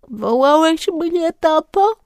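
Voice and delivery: put-on a drowsy child asking his dad; sleepy little boy. A narrator's reading. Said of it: "spytał, przecierając oczy". (zaspany chłopczyk, dużo wyższym głosem)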